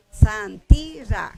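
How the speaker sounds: Santira slowly as san-TI-ra; slow dictation of san, TI, ra; syllable by syllable. Shown as a demonstration.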